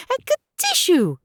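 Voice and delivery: a high squeaky sneeze acted out; loud sneeze. A narrator's reading. Narrator voicing Peter's sudden sneeze in the shed, high squeak. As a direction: sneeze it out like a little rabbit. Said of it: "Peter sneezed".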